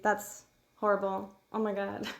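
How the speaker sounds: normal talking voice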